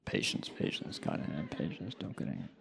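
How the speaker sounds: whispered mantra. Retold or imitated. Teacher reciting the mantra under his breath before the kid. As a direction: whisper the mantra to keep calm.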